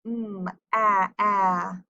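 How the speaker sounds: "m, a, a" as a hummed m, then two flat short "aah" sounds as in magnet; short vowel sounded out. Shown as a demonstration. Teacher isolating short "a" in magnet, sound by sound. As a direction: sound out "m, a", repeating the "a" of cat. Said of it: "a short vowel".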